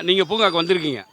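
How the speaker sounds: normal talking voice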